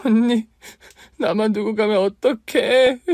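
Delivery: high, loud wailing sob; crying. Acted. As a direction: cry out at the grave, slowly. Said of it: "얼마나 울었는지 모릅니다".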